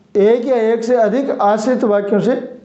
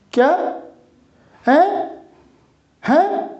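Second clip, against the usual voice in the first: sharply rising, much higher disbelieving huh; astonished; 7 semitones higher, slower than usual. Shown as a demonstration. Teacher showing surprise words, higher and higher in astonishment. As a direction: shoot up in pitch as if you can't believe it. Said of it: "आश्चर्य के लिए अरे".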